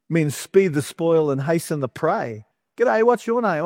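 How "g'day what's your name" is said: high-pitched, chummy G'day; comic meet-and-greet voice; mock friendly greeting. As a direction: play a stranger introducing himself, pitched up. Acting out another comic introduction.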